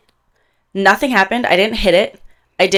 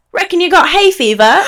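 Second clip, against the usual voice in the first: laughing, high mock British server voice; a hammed-up British accent through a laugh; imitating British accent; 9 semitones higher, 4 dB louder than usual. Imitating the British waitress's accent while laughing at her. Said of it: "But with her accent".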